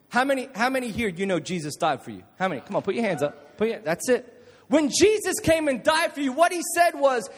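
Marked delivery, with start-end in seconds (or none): 4.7-7.3 preaching at full force. Acted. Preacher proclaiming freedom from rules to youth. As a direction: build it up with fervour.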